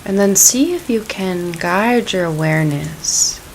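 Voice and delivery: calm, unhurried meditation voice; guided meditation. Acted. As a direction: ease them gently into the practice.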